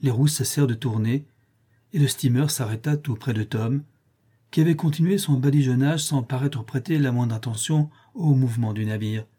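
plain reading voice

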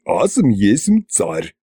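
slow, affronted regal declaration; indignant tsar. A narrator's reading. Koschei objects to being called a peasant, mildly indignant. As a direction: declare your rank slowly, mildly affronted. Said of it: "возмутился Кощей".

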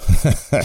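a brief chuckle; light chuckle of an old wizard; short amused laugh. A narrator's reading. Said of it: "Gandalf rió otra vez".

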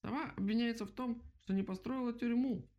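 very high squeaky accusation; mouse crying out. A narrator's reading. Mouse accuses the owl, crying out high. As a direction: squeal the charge out like a little mouse. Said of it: "закричала".

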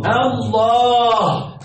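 الله said very slowly, the lam doubled and the long a held; drawn-out Allah. Shown as a demonstration. Teacher revealing the result to students, said very slowly. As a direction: draw الله out slowly as the finished word. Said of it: "ها".

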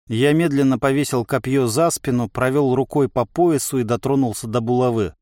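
ordinary delivery, nothing marked

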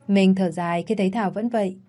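plain reading voice

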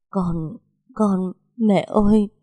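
nghẹn ngào gọi mẹ, chậm, cao hơn; vừa khóc vừa gọi mẹ; nức nở. Acted. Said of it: "Tôi khóc nấc lên". (con dâu khóc nấc gọi mẹ chồng)

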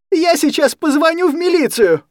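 very high, loud threat; screaming threat. Acted. Anya threatening to call the police, screaming. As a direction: scream it at him.

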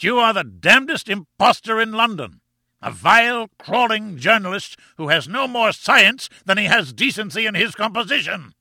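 high-pitched raging denunciation; blast of fury. A narrator's reading. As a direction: hurl the insults in a rage. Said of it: "with a sudden blast of fury".

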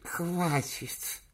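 put-on high, slow toothless old man; toothless mumble. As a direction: mumble through no teeth. Old chairman voiced.

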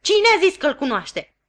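high, loud scolding; angry yell. Acted. Sister yelling at Joe, high and loud. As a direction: yell it at him. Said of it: "răgni sora mea".

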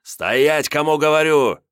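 high shouted order, rising and building; piercing shout. A narrator's reading. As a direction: yell an insistent command after runaways. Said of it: "с пронзительными криками". (пронзительный настойчивый крик, с нарастанием)

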